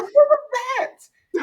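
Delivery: very high, squealed mock disbelief; stunned reaction. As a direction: squeal the question as if floored. Acts out shock at his voice.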